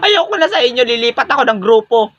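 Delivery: high, wailing fake sob while laughing; mock crying. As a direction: whine like a hurt kid about to cry. Karing whines after being insulted. (kunwaring umiiyak nang matinis)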